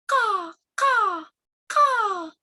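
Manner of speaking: high crow caws, falling away; crow cawing. Acted. Story's crow answers.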